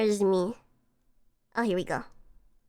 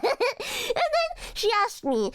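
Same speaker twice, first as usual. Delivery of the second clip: high giggling through the words; real laughter breaking the line; laughing; slower than usual. Real, not acted. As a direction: laugh it out as you speak. Laughing at own story.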